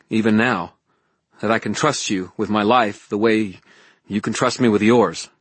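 insistent, heartfelt appeal; Harry pleading earnestly. Acted. Voices Harry pleading with Elaine.

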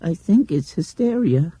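put-on high child voice repeating a whisper aloud; mimicking. A narrator's reading. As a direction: hand his own words back to him, pointed. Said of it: "then added".

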